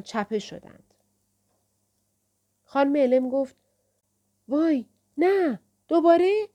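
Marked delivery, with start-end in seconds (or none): 4.5-6.5 high, loud cry of dread; oh-no alarm, pitched up sharply; alarmed dismay. A narrator's reading. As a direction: draw out the dismay, then rise into a startled question. Dismay as the library shakes again.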